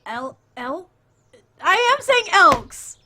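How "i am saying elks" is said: high, drawn-out yell of insistence; real worked-up shouting, near laughter; exasperated shout. Real, not acted. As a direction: shout every word in helpless protest. Repeated protest, getting louder.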